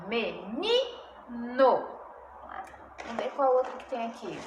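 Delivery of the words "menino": "Menino" very slowly as me, NI, no; stressed syllable demo. Shown as a demonstration. Teacher confirms tonic syllable, "Menino" split with NI stressed. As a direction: break "Menino" into syllables, leaning on ni, falling end. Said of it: "é a sílaba tônica".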